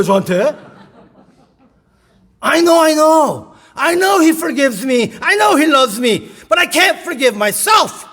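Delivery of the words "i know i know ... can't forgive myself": high and loud, slow, ending in a shout; irritated outburst. Retold or imitated. A sister snapping at her pastor in irritation, loud. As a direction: snap back in frustration. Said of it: "짜증을 내더라고요".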